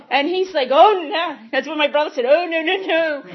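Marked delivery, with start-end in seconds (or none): 0.7-1.3 loud, high "Oh, no" of panic; alarmed brother. Retold or imitated. Brother's alarmed reaction retold. 2.2-3.2 loud, high "Oh, no" of panic; alarmed brother. Retold or imitated. Brother refusing, retold.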